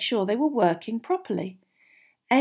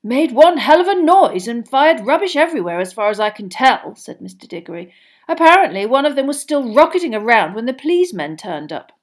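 Mr Diggory; 4 semitones higher, 9 dB louder than usual. A narrator's reading. Diggory voiced.

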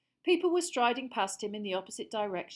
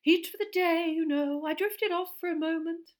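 dreamy professor; 9 semitones higher, 8 dB louder than usual. A narrator's reading. Dotty professor voiced.

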